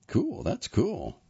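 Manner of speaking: slow, high lilting approval; drawn-out cool. A narrator's reading. Christopher nodding at Jack's career, slow lilting approval. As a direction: stretch each cool with a swooping pitch. Son politely answers Jack.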